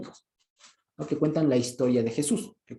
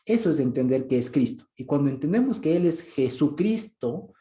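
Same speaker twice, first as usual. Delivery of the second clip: normal talking voice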